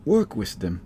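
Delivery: high elf voice; Valancy. Acted. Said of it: "Valancy is like".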